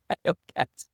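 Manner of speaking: I own cats said high and squeezed with a laugh; high squeaky joke take. Pretend. Pretend young man jokes about owning cats, high and laughing. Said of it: "repeated as a joke".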